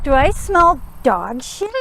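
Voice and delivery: loud shocked outburst; suddenly disgusted and loud; surprised. Real, not acted.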